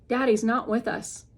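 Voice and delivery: ordinary delivery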